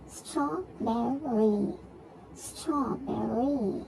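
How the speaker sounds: "Strawberry" very slow, in three drawn-out beats; syllable by syllable. Shown as a demonstration. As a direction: stretch out each syllable of "Strawberry". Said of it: "three syllables".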